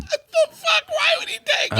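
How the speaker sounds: can't believe what you just heard; squealing incredulous question; real disbelief. Real, not acted. Listener reacts to the story.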